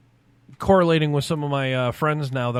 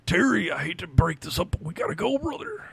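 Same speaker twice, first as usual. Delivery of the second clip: wrestler impression; 3 semitones higher, 3 dB quieter than usual. Wrestler telling Hulk Hogan time is up, wrestler impression. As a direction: play a wrestler cutting in apologetically.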